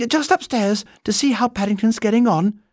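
put-on high, innocent-sounding girl; young girl voice. Judy's breezy excuse.

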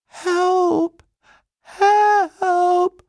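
very high, drawn-out cries; desperate shout. A narrator's reading. Paddington in the overflowing bath, shouting for help. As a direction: shout for rescue, stretching the word. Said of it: "shouted Paddington".

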